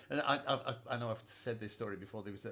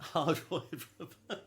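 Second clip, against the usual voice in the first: repeating the phrase through a real laugh; laughing echo; 6 semitones higher, 7 dB louder, slower than usual. Real, not acted. Host laughing as he echoes the guest's plectrum remark. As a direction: chuckle the words out, amused.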